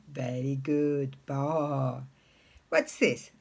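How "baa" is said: low, drawn-out sheep baa; long low baa, like a sheep; sheep bleat. Retold or imitated. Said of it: "what does the sheep say".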